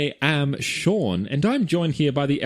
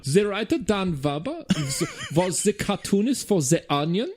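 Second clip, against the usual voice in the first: put-on high, laughing German accent, v for w; 5 semitones higher than usual. Host finishes the episode credits in mock German accent. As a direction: ham up a German announcer. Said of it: "we all did German accents".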